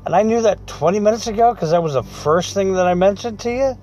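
can't believe it took this long; exasperated disbelief. Retold or imitated. Exasperated at wife's slow decision.

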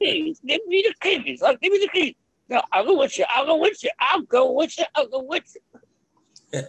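very high, pleading mock whine; whining Franklin. Imitating the character begging for keys, high whiny pleading. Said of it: "you know, whining".

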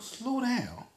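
high-pitched mimicking of her slow down; mock mumble. Quoting the masked old lady who told him slow down, mocking and high. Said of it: "She mumbled".

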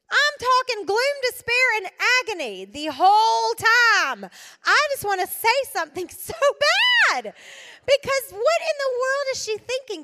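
high, loud, laughing disbelief; real exasperation breaking into laughter; exasperated outburst. Real, not acted. Exasperated by gloomy statuses.

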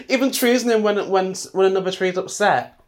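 fed-up rising rant; exasperated rant. Real, not acted. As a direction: let the outrage burst out. Ranting about cold-hearted people.

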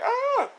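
very high imitation cry; mock scream. Woman in the film gets shot, mock scream.

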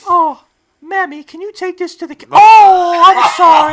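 very high, put-on royal falsetto rising to loud; hammed-up regal summons that bursts into a cry; the Queen. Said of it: "I'm being the queen".

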